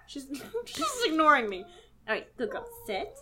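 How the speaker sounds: ordinary talk, nothing marked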